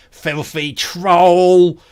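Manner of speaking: loud, drawn-out curse; dying enemy's insult. Acted. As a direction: spit it out slowly at full voice.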